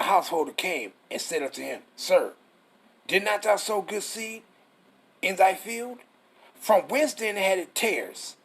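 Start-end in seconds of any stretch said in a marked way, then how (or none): none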